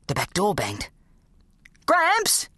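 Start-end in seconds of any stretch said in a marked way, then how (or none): 1.9-2.5 high desperate scream. A narrator's reading. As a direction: scream his name in panic. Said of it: "I screamed".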